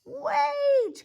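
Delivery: very high, loud cry; desperate cry. Acted. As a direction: cry out after them. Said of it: "cried Sheep".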